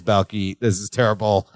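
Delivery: low, lightly voiced panicked Larry; Larry telling Balky of his panic, voiced low. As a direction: drop low for mock despair. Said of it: "he says".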